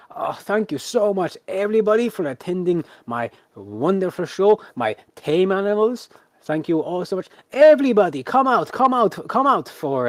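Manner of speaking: high, projected showman's curtain speech; grateful ringmaster. Acted. Ringmaster closes his animal show, effusive stage announcer. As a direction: thank the crowd grandly, building to loud calls.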